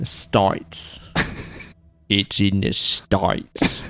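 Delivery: hushed, stretched "States" as "stoites"; Australian pronunciation. Shown as a demonstration. Practising Australian States, soft and drawn out as "stoites". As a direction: softly draw out States with an I. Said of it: "like there's an I in there".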